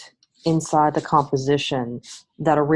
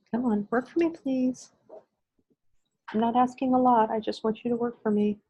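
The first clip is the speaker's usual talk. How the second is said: coaxing, a little higher, begging it to cooperate; pleading with a machine; 6 semitones higher, 4 dB quieter than usual. Real, not acted. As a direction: sweet-talk a stubborn gadget. Coaxing a frozen computer.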